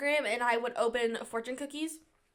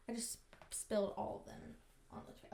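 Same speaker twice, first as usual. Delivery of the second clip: whispered aside; 2 semitones lower, 15 dB quieter than usual. Real, not acted. Aside, spilled fortunes.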